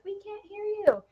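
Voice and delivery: high, soft mock complaint; imitating listeners. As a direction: mimic callers piping up from far away, pitched well up. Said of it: "people are like".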